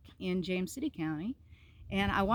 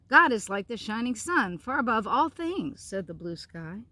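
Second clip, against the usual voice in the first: story narration. A narrator's reading. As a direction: read it like a bedtime tale, savouring each line. Reads picture book aloud.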